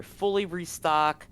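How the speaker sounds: usual speaking voice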